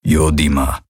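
low, level alien rumble; growling creature. A narrator's reading. Hocks names the dot on the map, low rumble.

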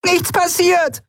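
very high-pitched acted cry; shouting. A narrator's reading. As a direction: yell it as if half deafened. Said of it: "schrie sie". (schrill hoch geschrien)

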